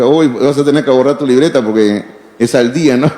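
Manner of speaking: retold joke said through a laugh. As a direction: chuckle while quoting yourself. Retelling his joking reply. (cita entre risas)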